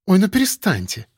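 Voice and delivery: coy, awkward protest; masking embarrassment. A narrator's reading. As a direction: wave it away, a touch embarrassed. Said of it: "маскируя неловкость".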